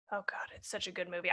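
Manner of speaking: breathy whispered aside; whispering. Real, not acted. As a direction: whisper it to yourself. Aside about loved movie.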